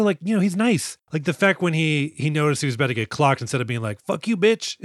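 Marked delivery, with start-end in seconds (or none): 4.0-4.8 higher-pitched playful insult; half-laughing mock aggression; mock hostile retort. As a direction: throw out a pretend angry comeback. Acted hostile reply, then laughs.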